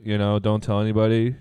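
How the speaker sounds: ordinary talk, nothing marked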